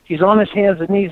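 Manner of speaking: ordinary talk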